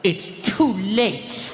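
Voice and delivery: very slow, drawn-out reproach; kid's attitude. Acted. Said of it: "give my mom unlimited attitude".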